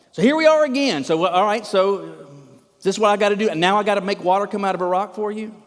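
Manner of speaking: fed up, bursting out high and loud; livid. Acted. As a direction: berate them for making you do it. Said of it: "he is livid".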